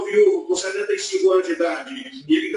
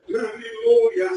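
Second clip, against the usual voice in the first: real crying aleluia, much higher and slow; tearful praise; 12 semitones higher, much slower than usual. Real, not acted. Preacher overcome by God's confirmation, crying out aleluia. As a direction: voice breaking with tears of joy. Overcome after answered prayer.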